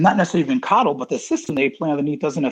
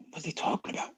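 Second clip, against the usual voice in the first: whispered inner thought; 2 semitones higher, 16 dB quieter than usual. Retold or imitated. Inner thought after boss's order.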